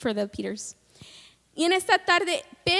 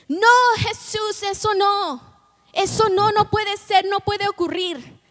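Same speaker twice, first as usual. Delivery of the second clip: protesting; 5 semitones higher, 5 dB louder than usual. Retold or imitated. Peter objects to Jesus about the crucifixion, high and loud. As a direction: cry out against the idea. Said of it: "Se mete y dice".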